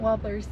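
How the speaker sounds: Wobblers, with no R in the first syllable. Retold or imitated. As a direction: say "Wobblers" imitating his missing R. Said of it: "he can't say the R in warbler".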